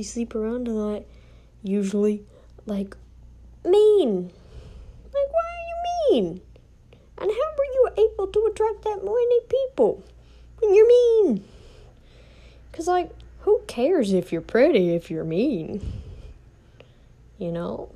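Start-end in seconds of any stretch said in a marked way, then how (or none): 3.6-15.1 high, loud incredulous outburst; loud indignant why; exasperated rant. Real, not acted. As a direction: vent at mean people, pitched way up. Ranting about mean people.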